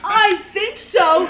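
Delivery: high, loud, slow mocking echo; incredulous mimicry. Daughter mocking mom's answer to the Mounties, loud incredulous mimicry. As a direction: throw it back exaggerated, through laughter.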